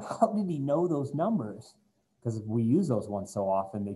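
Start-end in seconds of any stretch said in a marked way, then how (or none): 0.0-1.7 higher, puzzled student. Retold or imitated. Teacher voicing a baffled student, higher and puzzled. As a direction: play a baffled student wondering aloud. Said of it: "sitting there going".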